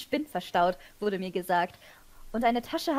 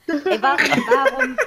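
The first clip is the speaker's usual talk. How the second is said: laughing disbelief; 3 semitones higher, 10 dB louder than usual. Real, not acted. Laughing at the pointless scene.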